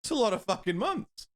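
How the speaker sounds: high, bright, over-the-top praise; shower him with compliments, pitch way up; gushing thanks. Real, not acted. Streamer thanking a returning supporter, gushing high praise.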